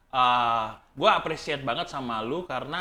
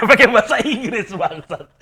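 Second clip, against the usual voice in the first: laughing; 7 semitones higher, 8 dB louder, slower than usual. Real, not acted. Laughing at himself.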